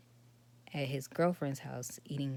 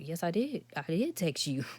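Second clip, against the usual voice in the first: louder, firm pushback; emphatic insistence; 4 semitones higher, 7 dB louder than usual. Real, not acted. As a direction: insist it firmly, voice raised. Insists to ex she texted.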